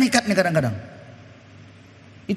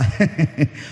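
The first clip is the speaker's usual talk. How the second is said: a low, short mock chuckle; a low, short laugh by the wife in the joke; wife's laugh; 6 semitones lower than usual. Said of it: "Istrinya ketawa".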